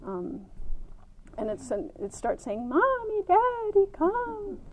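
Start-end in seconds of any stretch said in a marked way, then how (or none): 2.7-4.6 put-on high wailing little child, much higher; child crying for parents. Small child in bed wailing for mom and dad, high. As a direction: cry out like a small kid alone in bed. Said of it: "these heartrending cries".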